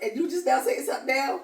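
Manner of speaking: hammed-up disbelief at a late complaint; mock disbelief. Friend answering a complaint six months late, mock disbelief.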